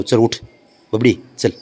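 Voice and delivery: ordinary talk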